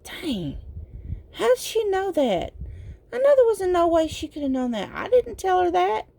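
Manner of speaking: high-pitched mock bewilderment; baffled partner. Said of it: "They're like".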